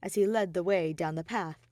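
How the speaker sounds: plain reading voice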